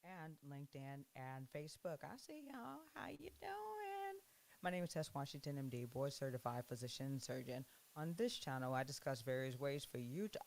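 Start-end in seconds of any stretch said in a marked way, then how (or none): 2.0-4.2 playful greeting. Real, not acted. Cheerful greeting to viewers.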